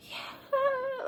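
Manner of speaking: very high, strained, crying little yeah; mock a faked lost voice; imitating the other driver playing victim; fake weak voice. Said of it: "she act like her voice was gone".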